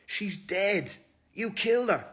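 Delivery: loud, high accusing yell; angry shout. Retold or imitated. Interrogator yelling at Ryan that he killed her, furious and loud. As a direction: hammer him with the accusation.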